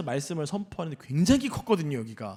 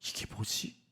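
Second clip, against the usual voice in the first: hushed, puzzled aside to oneself; soft, muttered self-questioning; whispered inner thought; 11 dB quieter, slower than usual. Retold or imitated. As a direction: whisper it as a private doubt. Voicing Jonah's puzzled inner thought.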